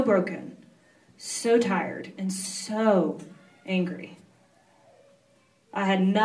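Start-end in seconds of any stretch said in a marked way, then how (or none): none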